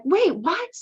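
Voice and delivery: a sudden jolt of disbelief; the whole call spots a wall of guitars, high stunned surprise; surprised. Retold or imitated. Team reacting to hidden guitars.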